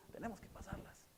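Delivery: soft whisper, leaning in; preacher whispering to his flock; whispered urging. Real, not acted. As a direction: whisper the lesson to them.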